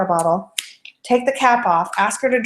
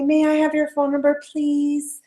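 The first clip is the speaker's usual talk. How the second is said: a higher, hesitant polite request; timid polite ask; 6 semitones higher, slower than usual. Shown as a demonstration. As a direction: ask as if afraid to bother. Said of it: "I didn't say".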